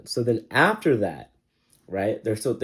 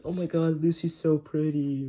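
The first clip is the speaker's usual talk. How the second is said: high, gushing swoon over Lucy; mock-dreamy fangirl voice, pitched up and slowed; gushing townsfolk; 7 semitones higher than usual. Townsfolk swooning over Lucy, high and gushing. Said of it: "everyone's like".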